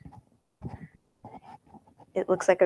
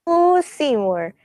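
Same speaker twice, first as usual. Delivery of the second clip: high, slow, drawn-out swoon; Little Shop chick; 7 semitones higher, 3 dB louder, much slower than usual. Acted. Imitating the chick from Little Shop of Horrors, high and drawn out. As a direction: play a lightly hammed-up musical heroine. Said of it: "impression of that one chick".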